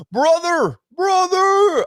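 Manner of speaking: very high, stretched call in a put-on accent; accented mock holler from afar; imitated calling out. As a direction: hail someone walking away. Cabbie calling after him, retold.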